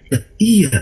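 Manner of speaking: high-pitched retold double take; startled realisation. Husband noticing sarong beside him, retold startled realisation. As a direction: let the voice jump up as it dawns.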